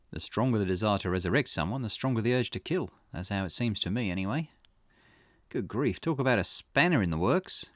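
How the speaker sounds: lightly voiced investigator, taking stock; Richter. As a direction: reason it through as a detective, lightly acted. Voices Richter reasoning with client.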